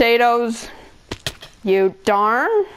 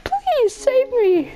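very high, slow squeaky bread voice; voicing bread; slower than usual. Kid voicing his bread begging to be saved. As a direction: plead as a tiny frightened loaf. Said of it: "my bread here is just like".

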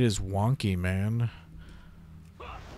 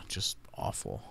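lower, whispered sigh of disgust; 12 semitones lower, 11 dB quieter than usual. Real, not acted. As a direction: let it out on a sigh. Sighing in disgust.

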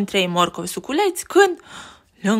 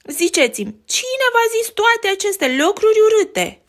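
Betty indignant; 6 semitones higher, 3 dB louder than usual. Acted. Betty defends her brothers.

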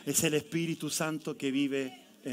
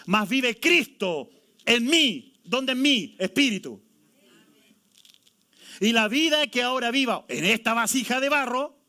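loud, slow, ringing scripture reading; preaching at full force; 4 semitones higher, 5 dB louder than usual. Acted. Pastor proclaiming Galatians to the congregation at full force. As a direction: proclaim the verse to the church, slowly.

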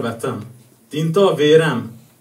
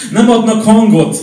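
loud, raised-pitch slam line; emphatic outburst; 8 semitones higher, 8 dB louder than usual. Acted. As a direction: throw the line out loud and high. Outburst within slam poem. (hangos, felcsattanó szavalás)

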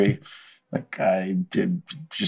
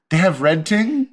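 surprised question; 7 semitones higher than usual. Retold or imitated. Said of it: "And I go".

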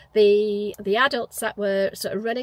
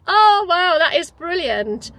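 high, loud burst of admiration; imitated delight; 7 semitones higher, 8 dB louder than usual. The group reacting to her reading the part, imitated delight. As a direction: gush like an impressed onlooker.

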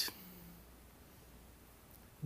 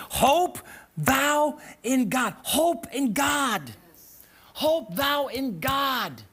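slow emphasis; 2 semitones higher, much slower than usual. Real, not acted. Preacher dwells on scripture.

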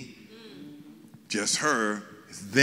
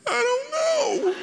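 put-on much higher, slow, laughing little girl's voice; imitating little daughter; slower than usual. Father imitating his little daughter, slow and much higher. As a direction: squeak it out like a confused little girl, slow. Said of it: "one of my daughters said".

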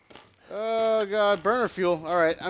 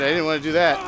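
normal talking voice

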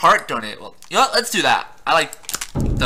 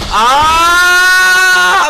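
loud, high, sustained scream; long scream; 9 semitones higher, 7 dB louder than usual. Pretend. Player running in the game, long panicked scream. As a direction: scream all the way through the run.

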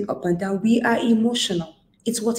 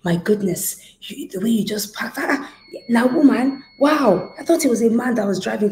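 higher, louder mock astonishment by the end; imitated amazed attendant; 4 semitones higher, 4 dB louder than usual. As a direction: imitate an onlooker bursting into disbelief. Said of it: "attendants will tell me something like".

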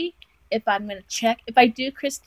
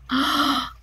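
high, sudden gasp; astonished gasp; 4 semitones higher than usual. Real, not acted. Seeing how amazing the gift is, astonished gasp. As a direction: gasp in delighted surprise. Next surprise in the box.

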